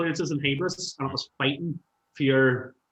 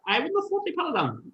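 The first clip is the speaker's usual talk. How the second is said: amazed; 13 semitones higher than usual. Retold or imitated. Retold amazement.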